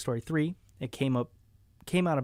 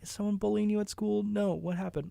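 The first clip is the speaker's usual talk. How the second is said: higher, lightly worried questioning; mild pitched-up concern; imitated concern; 8 semitones higher, 4 dB quieter than usual. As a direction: voice a worried wife gently. Imitates worried wife questioning him.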